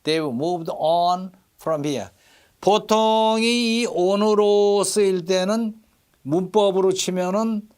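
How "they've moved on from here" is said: "on" drawn out and stressed. Shown as a demonstration. Teacher models the verse for students, stress on "on". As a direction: slowly, lean on the word "on". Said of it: "on에 강세가 들어가요".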